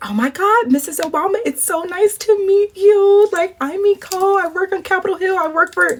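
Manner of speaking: very high, starstruck greeting; squeaky hammed-up excitement; gushing fangirl. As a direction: gush in a high-pitched, over-polite voice. Acting out gushing on meeting Obama.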